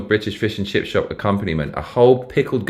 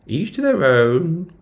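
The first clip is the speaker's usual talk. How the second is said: mock posh British; 9 semitones higher, slower than usual.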